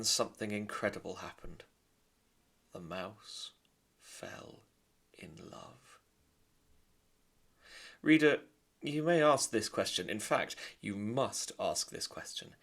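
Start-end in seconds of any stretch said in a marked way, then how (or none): 2.7-6.0 whispered reveal. A narrator's reading. Narrator to reader, a tender whisper. 8.0-10.1 louder and higher, calling to the reader; emphatic address. A narrator's reading. Narrator addressing reader, loud and emphatic.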